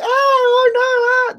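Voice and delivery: high, breathy damsel-in-distress squeal; fluttering cries for help. Retold or imitated. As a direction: shriek like a 1950s damsel in peril. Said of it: "That's my impression of a woman in distress".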